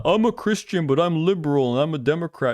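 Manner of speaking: lightly put-on whiny-celebrity impression. Streamer mocking an actor's political declarations, put-on voice. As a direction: mimic a self-declaring political type, mockingly. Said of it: "You know, the".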